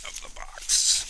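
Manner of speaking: strained, breathy push of effort; effort grunt. Real, not acted. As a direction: squeeze the words out while lifting. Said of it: "Sorry for my grunting and groaning".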